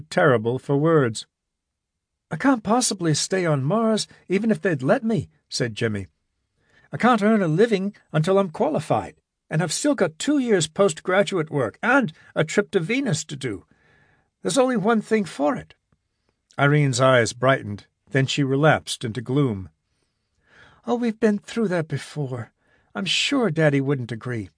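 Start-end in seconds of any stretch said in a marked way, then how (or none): 2.3-15.7 lightly voiced eager young man, higher; a hint of a youth making plans; young man Jimmy, earnest. Jimmy tells Irene his plan, lightly higher voice. 20.9-24.5 a hint of a lovesick young woman fretting; young woman Irene, fretful. Irene voiced by narrator.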